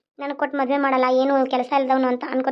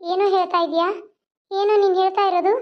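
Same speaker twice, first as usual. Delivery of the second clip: high put-on girl's voice, fretting; a frightened village belle complaining about the loafer; coy heroine voice raised high; 6 semitones higher than usual. Heroine shocked by the threat.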